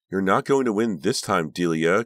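high, loud, threatening cry; angry shriek. A narrator's reading. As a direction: shriek it at a rival. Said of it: "Karina shrieked".

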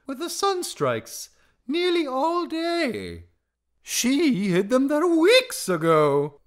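very high, loud, drawn-out singsong; carefree bird singing the answer; Darzee. A narrator's reading. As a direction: stretch it slowly into a piercing chant.

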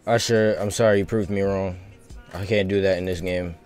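ordinary delivery

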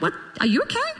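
high-pitched mock concern; put-on, high questioning squeak; husband's baffled question. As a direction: imitate a puzzled husband, voice lifting right up at the end. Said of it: "my husband be like".

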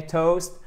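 toast with a gliding oh vowel; correct vowel. Shown as a demonstration. Teacher repeats the right word for learners, toast with a glide. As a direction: say toast as in English, with a diphthong. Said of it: "et non pas toast".

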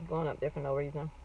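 talking while chewing; mouth full. Real, not acted. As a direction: hand over mouth eating. Seen 4: Raises food to mouth from 0.9s, bites and chews while talking.

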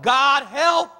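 loud, high cry to God; urgent shouted prayer; emphatic plea. Acted. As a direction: call out for help, pitch rising. Acts out emphatic prayer for help.

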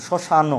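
শ্মশান as shoshano; added final vowel. Shown as a demonstration. Teacher repeating the unused form, শ্মশান with a final o. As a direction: end শ্মশান on a sounded o. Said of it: "বলি নাই কিন্তু".

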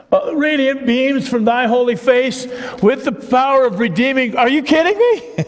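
rising delight, can't believe they miss it; excited. Real, not acted. Preacher marvels at Christmas hymns.

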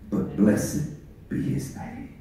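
whispered reverent ending; hushed prayer. Acted. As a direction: let the blessing fall to a whisper. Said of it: "to say".

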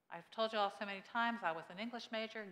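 normal talking voice

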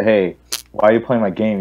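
normal talking voice

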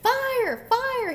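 very high, loud shout; cat crying out a false alarm. A narrator's reading. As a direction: yell a storybook alarm. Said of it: "he cried".

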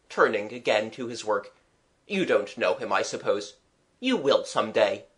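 ordinary delivery

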